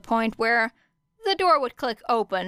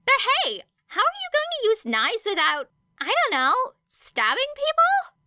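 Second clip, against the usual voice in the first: much higher, frowning question; a bubbly girl, confused and worried; puzzled girl voice. A narrator's reading. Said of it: "Ashido asked with a frown".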